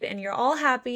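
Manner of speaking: ordinary delivery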